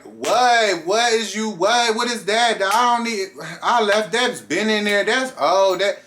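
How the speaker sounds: loud, high, indignant what-is-that; attitude. Retold or imitated. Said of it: "I'm getting attitude".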